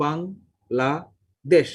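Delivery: Bangladesh broken into three slow syllables, with clear gaps. Shown as a demonstration. Teacher showing how the brain breaks up a word, Bangladesh syllable by syllable. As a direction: say Bangladesh very slowly as বাং, লা, দেশ. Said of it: "তিনটা syllable উচ্চারণ করবে".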